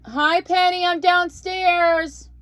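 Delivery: calling out loud and high to someone upstairs; yelling across the room. Real, not acted. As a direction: shout it up the stairs.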